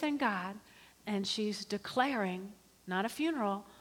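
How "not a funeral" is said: ordinary delivery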